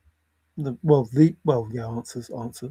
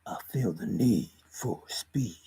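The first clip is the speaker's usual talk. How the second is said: hushed, slow, softer whisper; 9 semitones lower, 9 dB quieter than usual. Pretend. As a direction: breathe the line like a secret. Sudden whisper quoting movie line.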